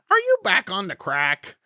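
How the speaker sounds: high loud mock-mom outburst. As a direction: shriek it like a shocked mom. Mother voiced in bit.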